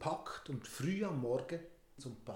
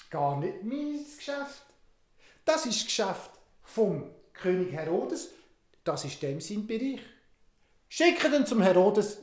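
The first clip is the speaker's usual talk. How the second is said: dismissive Pilate; 4 semitones higher, 8 dB louder, slower than usual. Acted. Pilatus thinks, passes Jesus on.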